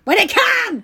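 high, loud, drawn-out protest; indignant shout. Real, not acted. Speaker defending the word crusty, indignant shout. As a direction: burst into comic indignation.

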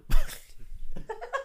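shrieking laughter; high squealing laugh. Real, not acted. Laughter at cockeyed joke.

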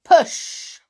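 loud, curt command; bossy order. A narrator's reading. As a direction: bark the order. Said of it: "ordered Amber".

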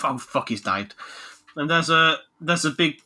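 usual speaking voice

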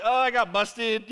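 loud, drawn-out mock dismay; caught out. Pastor caught reading commentaries by his wife, loud mock dismay. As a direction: own up loudly as if busted.

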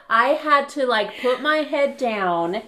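loud, high, words through laughter; laughing hard. Real, not acted. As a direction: fight through laughter to keep telling it. Still laughing at the story.

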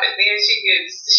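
usual speaking voice

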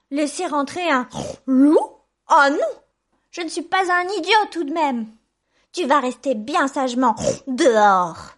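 high, loud piglet voice; indignant little pig. A narrator's reading. Third little pig to the wolf, indignant. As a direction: defy the wolf in a piglet squeal.

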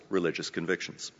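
ordinary delivery

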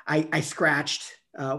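ordinary talk, nothing marked

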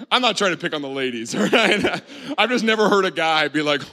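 usual speaking voice

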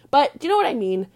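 ordinary talk, nothing marked

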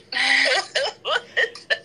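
high-pitched burst of laughter; amused laugh. Real, not acted. Listener reacting to a really weird story, incredulous laugh.

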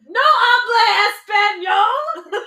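high, loud, drawn-out mock outburst; hammed-up indignant yell; imitated exasperated shout. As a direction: holler it slowly in comic outrage. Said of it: "a Penguin being like".

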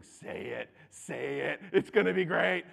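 lightly voiced inner tempter, building to eager; tempting inner urge. Body's chemicals urging him to speak, tempting.

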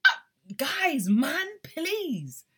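loud, high exclamation; impassioned plea. Real, not acted. Fan pleading with listeners after singing, impassioned. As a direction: cry out to the listeners, overwhelmed. Overcome by love for the song.

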